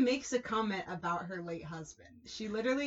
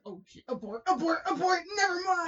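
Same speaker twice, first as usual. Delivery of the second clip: panicked; 8 semitones higher than usual. Retold or imitated. Panicked backing out.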